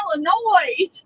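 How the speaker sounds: "Illinois" with a sounded S at the end; mispronounced Illinois. Retold or imitated. Girl baiting John about his pet peeve, mispronounced Illinois. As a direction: stretch "Illinois" out, ending like noise. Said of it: "somebody mispronounced Illinois".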